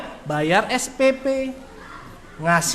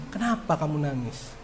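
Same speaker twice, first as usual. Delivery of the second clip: gentle teacher question; 8 semitones lower, 11 dB quieter than usual. Retold or imitated. Ustadz asks crying graduate.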